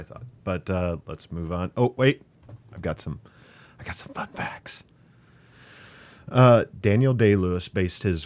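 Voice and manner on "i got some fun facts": playful stage whisper. Acted. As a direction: whisper it like a secret. Teasing whisper of fun facts.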